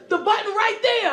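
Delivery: high-pitched insisting; exasperated insistence. Acted. Rescuer points trapped driver to the button, exasperated insistence. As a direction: point it out with mock impatience.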